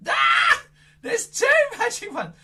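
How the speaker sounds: high loud gasp into laughing; hammed-up astonishment; mock surprise. Magician showing kids, high surprised laughing cry.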